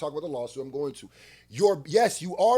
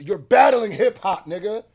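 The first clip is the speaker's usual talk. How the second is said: much louder, higher, jabbing the point; loud accusation; 4 semitones higher, 11 dB louder than usual. Real, not acted. Accusing [name] of fighting hip hop, shouting. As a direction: shout the charge at him. Ranting at [name] over lawsuit.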